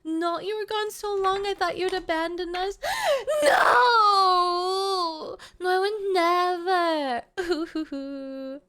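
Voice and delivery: hammed-up sobbing protest; mock wailing. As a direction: pretend to cry that they left. Mock wailing over abandonment.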